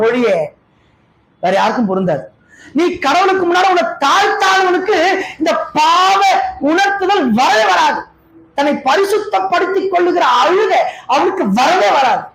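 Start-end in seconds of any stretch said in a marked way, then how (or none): 2.8-12.2 high, ringing preacher's warning; preaching at full force. Real, not acted. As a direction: thunder it out to the congregation. (முழு வீச்சில் பிரசங்க முழக்கம்)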